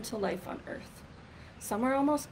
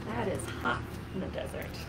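lively, sing-song picture-book reading; rhythmic, louder read-aloud storytelling voice; reading to children; 4 semitones higher, 5 dB louder than usual. Acted.

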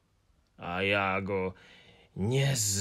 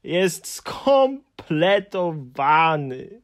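very slow, louder, higher wailing lament; despairing; 7 semitones higher, 7 dB louder, slower than usual. A narrator's reading. Hemulen lamenting his complete collection in despair. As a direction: wring out each word in despair. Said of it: "z rozpaczą".

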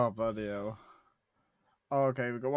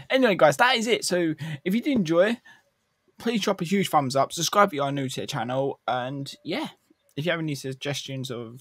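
loud, higher YouTuber sign-off; upbeat outro; 4 semitones higher, 7 dB louder than usual. Acted. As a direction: pump up the channel outro.

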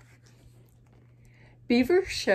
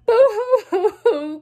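high, loud hammed-up sobbing; boo-hoo like a toddler, high and loud; mock crying; 10 semitones higher, 10 dB louder than usual. Bird cries to friends in hammed-up high sobs. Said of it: "cried Bird".

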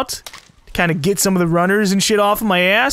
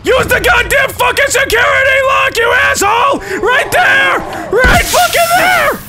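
high, loud enraged screaming; furious shout; 5 dB louder, slower than usual. Real, not acted. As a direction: scream at him in fury. Yelling at the game character.

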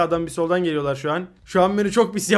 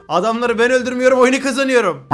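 usual speaking voice

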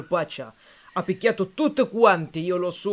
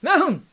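throat clearing; 8 semitones higher than usual. A narrator's reading. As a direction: clear your throat grandly. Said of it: "si schiarì bene la voce".

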